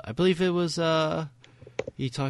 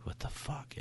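near-silent breathy mouthing of the words; mouthed what the fuck; 7 semitones higher, 14 dB quieter than usual. Retold or imitated. Friend retelling the film's look, mouthing it silently. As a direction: mime the line, barely any voice. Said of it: "gives the face that goes".

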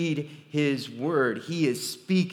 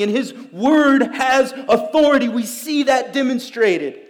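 rising, louder preacher's proclamation; fervent preaching; 8 semitones higher, 11 dB louder than usual. Acted. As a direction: build it like a pulpit climax. Preaching at full force.

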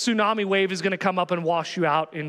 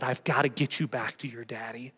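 tender reassurance; 10 semitones lower, 6 dB quieter than usual. Acted. Jesus carrying the lost child home.

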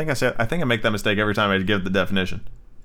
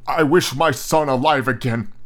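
slow, higher, deliberate wish; solemn wish; 4 semitones higher, slower than usual. A narrator's reading. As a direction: intone it slowly like an incantation. Old man makes the wish.

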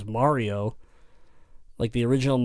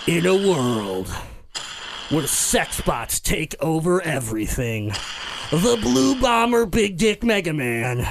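slow, booming trailer narrator; movie trailer voice; 3 semitones higher, slower than usual. Acted. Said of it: "Pitch a gritty R-rated cinematic".

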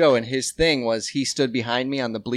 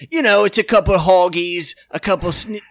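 mock British accent; 4 semitones higher than usual. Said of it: "And he's like".

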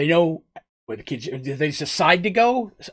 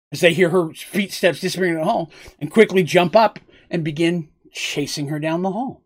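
ordinary talk

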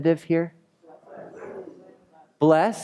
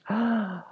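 high, soft drawn-in gasp; mock gasp; 10 semitones higher, 5 dB quieter than usual. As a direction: gasp as if it just dawned on you. Mock shock at Scrooge reveal.

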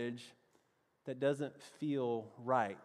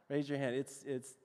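ordinary talk, nothing marked

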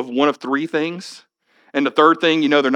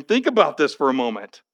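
ordinary talk

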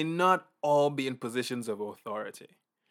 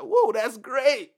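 delighted laugh; 9 semitones higher, 5 dB louder, slower than usual. Pretend. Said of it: "It's like".

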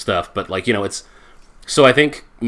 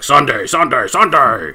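monster truck ad; 3 semitones higher, 4 dB louder than usual. Retold or imitated. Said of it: "the radio announcer voice, like, the one that's, like, for monster trucks".

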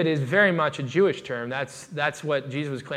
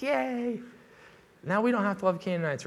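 higher, bright mock cheer of the crowd; 5 semitones higher than usual. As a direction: cheer it like a smug onlooker. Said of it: "cheering, like".

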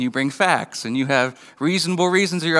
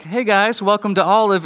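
bright high waitress voice; perky waitress greeting; 6 semitones higher than usual. Retold or imitated. Mother imitating the waitress greeting the family, high and bright. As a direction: chirp it like a cheerful server.

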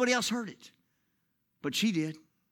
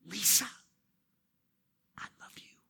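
hushed name, then a bare whisper; barely audible, intimate; 8 dB quieter than usual. Shown as a demonstration. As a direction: whisper it tenderly to one person. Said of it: "So that's why I'm whispering".

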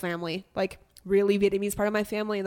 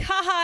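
high, loud fake "ha ha"; mock laugh; 11 semitones higher, 6 dB louder than usual. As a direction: imitate someone laughing, pitched way up. Imitating people laughing at her.

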